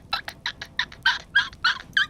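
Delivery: squeaky nervous giggle; high nervous laugh. Real, not acted. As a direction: laugh off the fright. Nervous laugh after fright.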